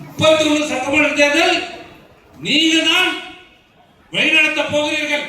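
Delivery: high, ringing platform delivery; rally the room with measured, high-pitched phrases; rousing political oratory. Real, not acted. Party leader handing young members the coming elections, rousing oratory.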